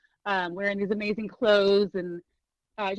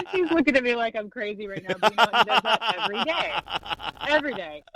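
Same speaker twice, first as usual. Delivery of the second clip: laughing; 4 dB louder, faster than usual. Real, not acted.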